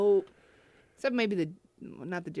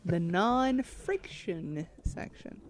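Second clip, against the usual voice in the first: slow repeat; 6 dB quieter, much slower than usual. Acted.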